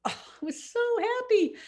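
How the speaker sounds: happy laugh. Retold or imitated. Joyful memory, laugh heard.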